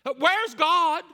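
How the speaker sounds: loud, high-pitched cry to God; calling out. Acted. Prophet calling out to an absent God, loud demanding cry. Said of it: "Habakkuk is saying".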